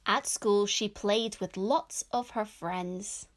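loud, clear storybook reading; children's story narration. A narrator's reading. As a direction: read it out to young children, projected. Reads picture book aloud.

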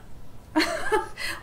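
breathy, high unbelieving laugh; scoffing chuckle at a silly claim; incredulous laugh. Acted. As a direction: laugh off the kid's idea. Man laughs at boy's idea.

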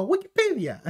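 high-pitched mock British accent; hammed-up British exclamation; Henry Cavill. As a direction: imitate a startled, very British pronunciation. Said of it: "Habla como".

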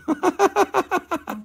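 high, rapid-fire chuckle; high staccato laugh. Real, not acted. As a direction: laugh in a string of high pulses. Laughs at his own joke.

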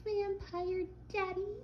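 teasing his girlfriend with a high, slow mock swoon. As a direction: mimic her gushing in a squeaky voice. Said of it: "And then you'll be like".

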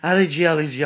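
calling the dog. Real, not acted.

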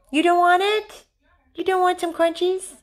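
very high coaxing voice to a dog; high-pitched pet talk. Real, not acted. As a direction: tempt the dog in a sing-song squeak.

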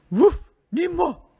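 loud woof, then short refusal; dog voice. A narrator's reading. Dog refusing to help the hen, loud barking voice. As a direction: bark it out like a dog. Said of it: "dit le chien".

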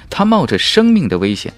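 plain reading voice

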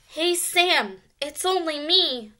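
high little mouse voice; alarmed squeak. A narrator's reading. Mouse to the dog Sam, squeaking in alarm. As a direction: squeak it anxiously. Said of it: "squeaked Ralph in alarm".

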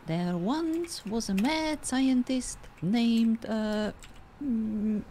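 storytelling opening. Acted. Starts the made-up story.

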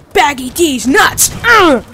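loud jokey yell with "nuts" thrown up high. Real, not acted. Player yelling at the monster mid-fight, loud and gleeful. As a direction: bellow it at the monster.

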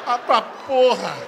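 slow, loud, drawn-out curse; exasperated. Retold or imitated. Desabafo exasperado ao saber do vídeo. (palavrão exasperado, lento e alto)

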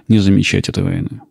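ordinary talk, nothing marked

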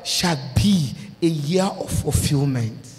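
drawn-out pronouncement of the coming year; slow preached declaration. Real, not acted. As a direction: lay out each blessing slowly, like a prophecy.

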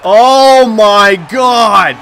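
very loud, high, drawn-out disbelief; exasperated shout. Real, not acted. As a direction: yell it in outraged frustration. Furious after opponent scores again.

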